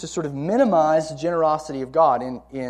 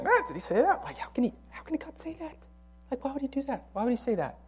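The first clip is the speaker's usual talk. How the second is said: high, hushed, doubting questions; insinuating serpent; 9 semitones higher, 8 dB quieter, faster than usual. Acted. Serpent planting doubt in the woman about God's word, insinuating. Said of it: "and then saying".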